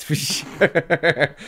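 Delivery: words stretched out by laughter; real laughing. Real, not acted. Comic admitting his set emptied the room, laughing.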